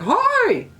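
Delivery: a parrot's English-voiced hello; parrot saying hi. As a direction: chirp it like the bird greeting you. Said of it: "he has an English voice".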